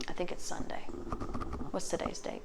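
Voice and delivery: off-mic whisper; whispered aside. Real, not acted. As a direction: drop to a whisper while checking the calendar. Checking the date, aside.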